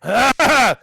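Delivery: high, loud yelled "ah"; sudden scream. Acted. Player trying to rouse unconscious Sarah, sudden scream. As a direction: let out a sharp, loud cry. Acted cry while waking Sarah.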